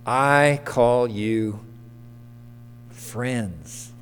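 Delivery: slow, soft, drawn-out I call you friends; slow emphasis on friends. Acted. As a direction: let friends fall away softly. Slow emphatic scripture quote.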